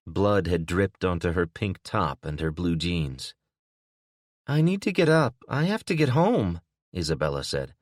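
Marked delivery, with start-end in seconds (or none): 4.5-6.6 much higher, lightly voiced anxious woman; a hint of a panicked young woman; insistent injured woman. A narrator's reading.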